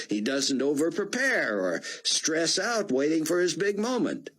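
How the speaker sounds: put-on high, foreign-sounding older gentleman; old Israeli scholar. Rosenzweig voiced by the narrator.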